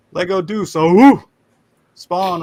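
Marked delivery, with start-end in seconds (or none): 0.8-1.2 loud whooped battle cry; Spartan war cry. Acted. Host echoes Lego Deuce, loud war cry. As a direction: whoop it like a rallying chant.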